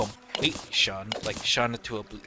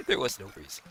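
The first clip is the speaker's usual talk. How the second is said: laughing repeat; 4 semitones lower, 3 dB quieter, faster than usual. Real, not acted.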